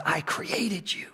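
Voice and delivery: slow, breath-soft whisper; gentle whisper. Acted. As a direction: whisper it slowly as God's reassurance. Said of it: "the gentle whisperer tells you things like".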